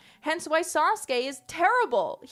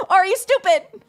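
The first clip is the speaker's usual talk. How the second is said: much higher and louder, laughing mock disbelief; mock incredulous; 10 semitones higher, 12 dB louder than usual. Fan mocking the writer with loud laughing disbelief. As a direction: jeer at him through laughter.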